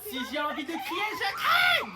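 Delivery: very high, very loud scream. Acted. Mother screaming that she'll shout if she wants, loud. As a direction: scream it at full force.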